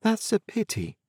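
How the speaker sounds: Biddy softly and sorrowfully regretting Pip's discontent; sorrowful air. A narrator's reading. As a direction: let the pity sink into a soft sigh. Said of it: "with a sorrowful air".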